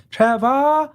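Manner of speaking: high, sing-song call, last syllable held; musical mom calling. Retold or imitated. Mom calls her son across the house, musical high holler. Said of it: "very musical way of speaking, my mom".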